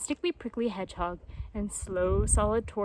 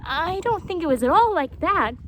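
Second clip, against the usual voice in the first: higher, louder, puzzled jaguar; Painted Jaguar; 6 semitones higher, 6 dB louder than usual. A narrator's reading. Jaguar protests, puzzled and loud. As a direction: protest in confusion.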